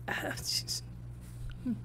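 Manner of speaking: breathy whispered ah jeez; exasperated whisper to herself; whispered groan. Real, not acted. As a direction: mutter it under your breath in disbelief. Exasperated reaction to clip.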